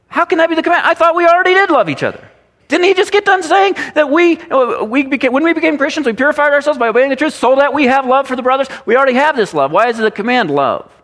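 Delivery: high, quick voice of a confused churchgoer; puzzled objector. Pretend. Imagined churchgoer questioning the preacher, puzzled high objection. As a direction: push back as if the command makes no sense. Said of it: "You say".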